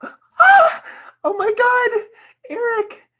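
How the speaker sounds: high shrieking cry of delight; retold shriek. Friend retelling her dream proposal, shrieks with joy. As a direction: squeal his name in shocked joy. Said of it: "like, shrieked".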